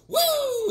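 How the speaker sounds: high whooping woo; whoop of joy. Acted. Fisherman cheering after eating the plant, joyful whoop. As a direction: let out a triumphant whoop. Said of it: "he goes".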